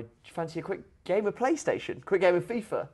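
a hint of a hyped-up teen; lightly voiced eager invite; eager young boxer. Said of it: "he says to Andy Murray".

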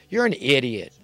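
ordinary delivery

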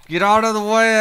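loud, high frustrated shout. Real, not acted. Repeats the command louder.

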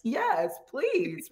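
a hungry, thrilled agreement; mock-elated yes, please; eager yes. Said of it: "I have never felt, like, more just like".

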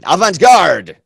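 loud, high proclamation of the title; theatrical shout; dramatic announcement. Acted. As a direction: boom out the title like a grand unveiling. Teacher dramatically announces the topic.

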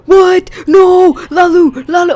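high, very loud cries of horror; shocked scream. Real, not acted. Cow is accidentally killed.

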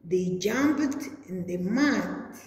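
normal talking voice

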